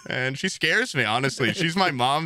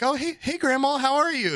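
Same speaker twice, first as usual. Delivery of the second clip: bright, much higher sing-song hello; cheery high greeting; 12 semitones higher than usual. Retold or imitated. Greeting his grandma in a high sweet voice. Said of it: "I'm like".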